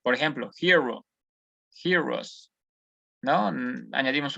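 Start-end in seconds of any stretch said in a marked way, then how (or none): 0.6-2.5 "hero" then "heroes" said in English; teacher demo of "hero" and "heroes"; English pronunciation. Shown as a demonstration. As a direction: model "hero" and "heroes" in turn. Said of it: "se añade una E S al final".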